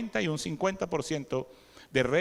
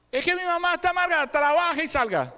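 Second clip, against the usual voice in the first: mock whiny complaint; 8 semitones higher, 3 dB louder than usual. Imitating complaining adult child.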